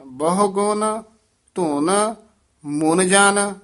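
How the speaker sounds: ਬਹੁ ਗੁਣ, ਧੁਨ, ਮੁਨ ਜਨ in three separate groups; short halts round ਧੁਨ, ਮੁਨ ਜਨ joined; phrased with pauses. Shown as a demonstration. Teacher models phrasing for pupils, pauses either side of ਧੁਨ. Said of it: "ਇੱਥੇ ਠਮਕ ਲਾ ਦੇਣੀ ਹੈ".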